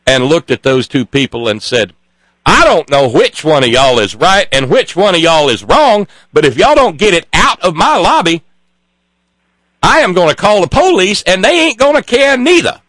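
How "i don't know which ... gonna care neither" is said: put-on a desk clerk laying down the law in a Southern accent. Desk clerk scolding two bus crews in the lobby, Southern accent. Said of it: "I'm not mocking when I do this accent".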